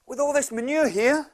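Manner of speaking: put-on high, loud eager kid; excited boy. Optimistic boy tells his dad about the pony, high and excited. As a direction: bubble over like a thrilled little boy. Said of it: "He said, he said".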